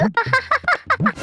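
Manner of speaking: high burst of laughter. Acted. Laughing at friend's face. (పెద్దగా నవ్వు)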